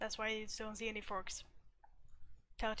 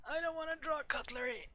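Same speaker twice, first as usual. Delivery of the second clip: higher, whining complaint; whiny protest; 5 semitones higher than usual. Real, not acted. Artist balking at drawing cutlery, whiny protest.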